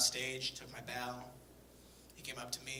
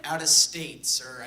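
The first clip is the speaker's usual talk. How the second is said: loud, punchy comeback to a heckler; loud retort; 10 dB louder, slower than usual. Real, not acted. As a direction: shout it out to the crowd, much louder. Shouting to heckler in crowd.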